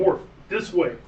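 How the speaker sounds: commanding shout to follow; calling out. Acted. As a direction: call the dwarf over, in character. Player calls to dwarf in character.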